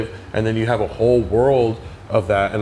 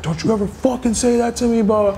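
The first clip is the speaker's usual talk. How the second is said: angry yell; 12 semitones higher than usual. Retold or imitated. Delivery guy yelling in altercation.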